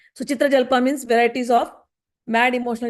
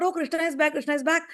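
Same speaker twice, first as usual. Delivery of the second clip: excited; 5 semitones higher, 5 dB quieter than usual. Retold or imitated. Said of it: "their excitement was very high".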